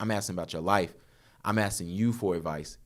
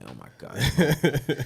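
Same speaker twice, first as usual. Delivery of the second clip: slow, drawn-out embarrassed groan; exasperated groan; 4 semitones higher, 3 dB louder, much slower than usual. Retold or imitated. Son groaning at his mom's pun, slow and embarrassed. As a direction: drag it out like you can't believe her.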